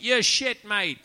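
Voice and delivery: loud, high heckler's jeer; a London heckler shouting at the comic on stage, loud and high. Retold or imitated. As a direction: yell it up from the back of the crowd. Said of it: "the guy piped up and he went".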